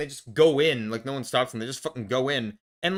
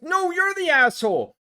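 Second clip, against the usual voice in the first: high mocking retort; 10 semitones higher, 4 dB louder than usual. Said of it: "And it's like".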